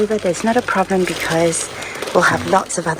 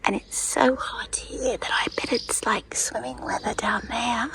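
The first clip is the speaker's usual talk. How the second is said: whispering on the phone; 3 semitones higher, 8 dB quieter than usual. Real, not acted. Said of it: "I have to whisper".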